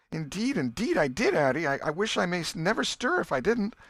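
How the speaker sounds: put-on earnest boy swearing. Tom swearing to his aunt he's telling the truth. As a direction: swear it's true, desperate.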